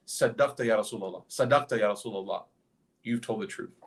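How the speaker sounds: ordinary talk, nothing marked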